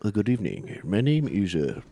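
tentative put-on Chinese accent. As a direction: softly attempt a Chinese accent.

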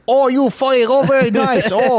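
put-on loud, very high caricatured Chinese accent; hammed-up Chinese accent, high and loud; put-on Chinese accent at full volume; mock Chinese accent. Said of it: "I said it in a Chinese accent".

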